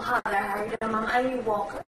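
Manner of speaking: normal talking voice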